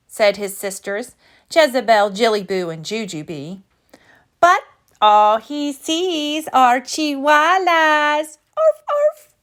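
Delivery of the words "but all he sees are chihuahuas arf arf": slow teasing, then high yappy arf; mock the brother, then bark; little sister yapping. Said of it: "barked Jujubee".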